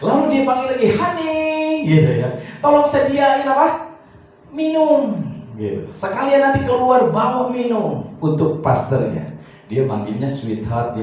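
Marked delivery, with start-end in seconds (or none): 0.9-1.9 much higher, drawn-out sweet call; grandpa calling wife. Retold or imitated. Grandpa calls his wife honey, higher and sweet. 2.6-5.2 higher, fond asking voice; grandpa's request. Retold or imitated. Grandpa asks his wife for drinks, higher and fond. 6.0-9.3 higher, fond asking voice; grandpa's request. Retold or imitated. Grandpa asks his wife for drinks, higher and fond.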